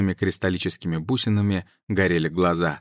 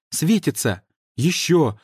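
excited; 8 semitones higher, slower than usual. Acted. Girls exclaiming at crystals.

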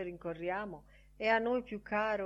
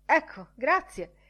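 high, loud, pushy greeting voice; brash loud Bantam Lyons; 8 semitones higher, 11 dB louder than usual. Acted. Bantam Lyons hails Bloom for his newspaper, loud and high. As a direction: barge in loudly and grab the paper.

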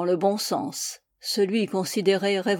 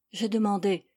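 ordinary delivery, nothing marked

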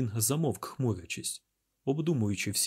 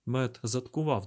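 ordinary delivery, nothing marked

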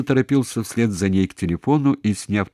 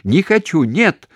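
high, loud, pleading refusals; insistent, desperate; 10 semitones higher, 6 dB louder, slower than usual. A narrator's reading. Daughter forbids mother by phone, desperate and insistent. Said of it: "настойчиво, отчаянно повторила".

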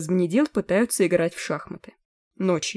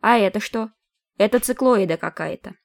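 thin voice; 3 semitones higher than usual. A narrator's reading. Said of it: "тонким голосом".

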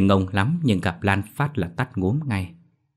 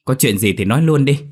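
higher, louder irritated order; angry bark; 6 semitones higher, 6 dB louder than usual. A narrator's reading. Annoyed sister barks at her brother to get to the point. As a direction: cut him off, fed up. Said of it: "Tức quá tôi liền quát".

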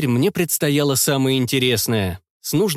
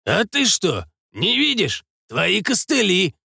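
mock surprise; 6 semitones higher, slower than usual. Doc answers with mock surprise.

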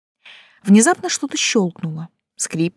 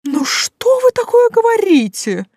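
much higher, slow indignant butler; sincerely indignant; 5 dB louder than usual. A narrator's reading. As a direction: squeal of earnest outrage. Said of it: "искренне возмутился Сильвио".

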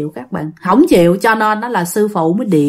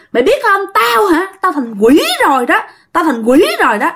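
boastful demon; 7 semitones higher than usual. Acted.

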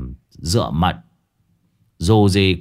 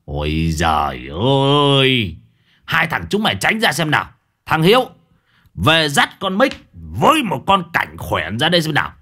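fed-up scolding; 4 semitones higher than usual. A narrator's reading. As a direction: shoo the disciples off, annoyed. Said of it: "đoạn ông mắng".

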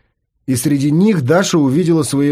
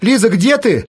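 shouting; 6 semitones higher, slower than usual. Acted. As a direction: call out for yourself in earnest. Lisa now shouting for herself. (громкий крик, выше обычного)

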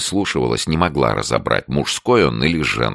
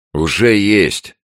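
higher, louder, drawn-out reply; raised interjection; 8 semitones higher, 5 dB louder, slower than usual. A narrator's reading. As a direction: cut in slowly and firmly. Host interrupts the shouting neighbour. (громко, с растяжкой)

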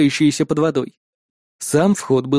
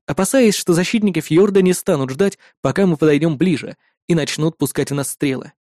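ordinary delivery, nothing marked

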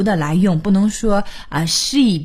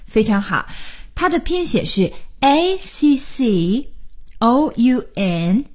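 ordinary delivery

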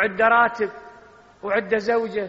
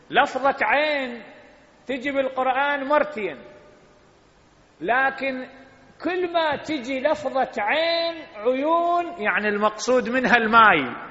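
impassioned preaching; 7 semitones higher, 4 dB louder than usual. Acted. Preacher explaining a Quran word to the congregation, impassioned. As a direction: drive the point home from the pulpit.

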